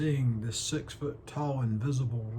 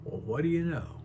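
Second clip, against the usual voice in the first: Elwood, genial storyteller; 2 semitones higher than usual. Acted. Elwood answering the doctor about the rabbit's name, genial storytelling. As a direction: play an eccentric gentleman recounting a curious tale, pitch often lifting. Performs the Harvey monologue as Elwood.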